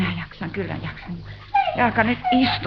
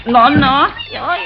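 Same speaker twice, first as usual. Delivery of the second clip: normal talking voice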